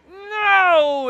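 high, loud cry of dismay; dismayed shout. Real, not acted. Gamer yelling as his shot gets deflected, dismayed cry. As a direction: yell it as the shot is blocked.

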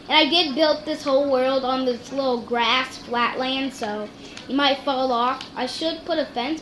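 ordinary talk

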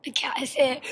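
hushed whisper. Real, not acted. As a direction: breathe it out in a whisper. Whispering about the cat nearby.

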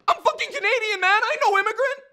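very high, loud desperate protest; indignant shout. Acted. Nigel protesting he is Canadian, high indignant shout. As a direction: scream the denial at full force.

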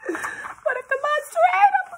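very high squeaky worry; high-pitched mock fright; frightened question. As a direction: squeak it out in storybook fear. Scared animal asks in story.

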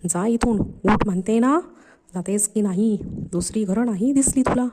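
plain reading voice

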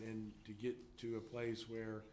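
usual speaking voice

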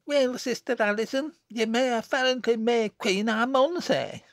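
put-on high-pitched spiteful girl with broad Lancashire dialect. A narrator's reading. Jennet to her sister the May Queen, spitefully. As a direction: needle her, voice pitched well up. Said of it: "she observed spitefully".

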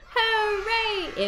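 high, loud cheer of hooray. Acted. Leader cheers with the children, high happy shout. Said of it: "shout hooray".

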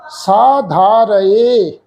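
ਸਾ ਧਾ ਰਹੇ said very slowly, "saa dhaa ra-e"; correct pronunciation. Shown as a demonstration. Teacher models the correct reading ਸਾ ਧਾ ਰਹੇ slowly. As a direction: draw out ਰਹੇ as "ra-e". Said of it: "ਇਸ ਢੰਗ ਦੇ ਨਾਲ".

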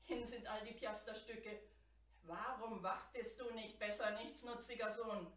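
father's loud, desperate scolding; desperate outcry. A narrator's reading. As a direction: cry out in despair over the lost money. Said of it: "schrie er verzweifelt auf".

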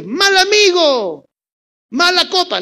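high, slow mock-weeping shout; tearful accusation. Marta scolding Jesus, high in hammed-up tearful shouting. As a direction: sob out the insult, half joking. Casi le dice.

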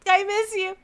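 high-pitched, aching exclamation; pining outburst. Acted. Reader of a lover's text, high and pining. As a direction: burst out with longing.

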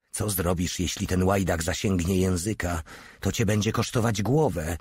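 plain reading voice

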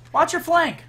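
high loud callout; sharp shout. Real, not acted. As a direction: yell it as a taunt. Shouting in firefight.

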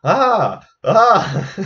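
high, loud screams of terror; terrified cry. Acted. Fleeing merchant collapsing before the soba seller, loud high terrified cries. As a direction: shriek in panic at the seller's feet. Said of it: "crying out".

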